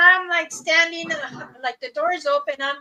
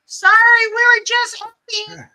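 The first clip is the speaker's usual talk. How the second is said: sheepish apology; 7 semitones higher, 8 dB louder than usual. Retold or imitated. Boy apologizing to cop.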